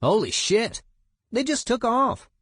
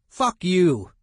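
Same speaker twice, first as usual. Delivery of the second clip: loud defiant yell, pitched up; yelled back; 7 semitones higher, 7 dB louder than usual. Retold or imitated. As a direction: yell it back at the guy harassing you. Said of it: "I yelled back at him".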